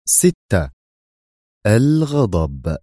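slow, weighty title announcement; dramatic title read. A narrator's reading. Narrator announcing the chapter title, slow and grave.

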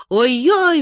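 high, loud squeal. Acted. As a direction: yelp it out sharply and high. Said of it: "взвизгнула она".

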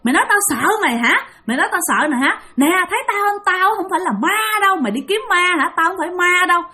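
high-pitched, confrontational ghost woman; menacing demon woman. Retold or imitated. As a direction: challenge a trespasser as an angry demon. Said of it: "Bà này càng lúc càng lại gần mà nói vậy đó". (giọng bà quỷ hăm dọa, cao gắt)

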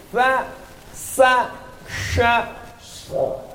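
"Fa, sa, sha, so" each letter sounded apart; "so" ending as a bare unvoiced breath; letter demo. Shown as a demonstration. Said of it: "mesti fasih".

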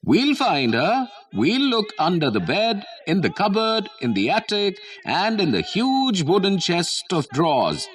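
mock shouting kids. A narrator's reading. Kids vowing to hunt out Juhi, mock shouting. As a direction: call it out high as a gleeful hunt. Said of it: "shouted the kids".

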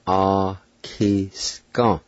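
"Akiskan" slowly, ah, kees, kah; "Akiskan" measured and drawn out; slow pronunciation demo. Shown as a demonstration. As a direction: draw out each syllable of "Akiskan". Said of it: "now pronounce these words".

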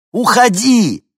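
urgent high yell; shouting. A narrator's reading. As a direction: call out sharply, loud. Hinkin repeats warning.

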